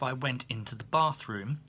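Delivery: usual speaking voice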